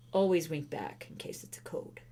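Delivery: unvoiced secret whisper. Real, not acted. Whispered aside.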